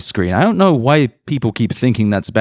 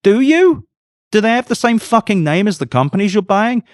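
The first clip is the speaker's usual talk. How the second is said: high-pitched, indignant rhetorical question; incredulous challenge; 11 semitones higher than usual. Real, not acted. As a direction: throw the excuse back in disbelief. Challenges Altman's quoted excuse.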